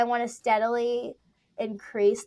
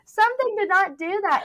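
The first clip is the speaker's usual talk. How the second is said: higher and louder, half laughing; loud high laughing exasperation; 10 semitones higher, 10 dB louder than usual. Retold or imitated. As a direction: mais alto e mais agudo, meio rindo. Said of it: "And I was like".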